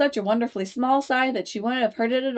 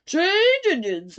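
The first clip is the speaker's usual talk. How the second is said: slow, raised hoarse croak; 10 semitones higher, 4 dB louder, much slower than usual. A narrator's reading. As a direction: croak it like a horse. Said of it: "a hoarse voice spoke next".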